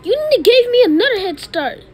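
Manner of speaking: high, loud outraged wail; high loud game rage; frustrated yell. Real, not acted. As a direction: shriek as you lose. Losing game.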